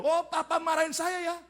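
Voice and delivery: put-on high-pitched child's threat; defiant child. Boy threatening his scolding father, defiant.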